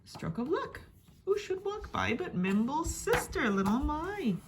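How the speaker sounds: ordinary delivery, nothing marked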